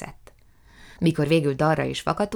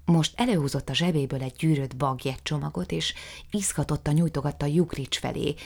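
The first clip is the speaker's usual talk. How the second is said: ordinary delivery, nothing marked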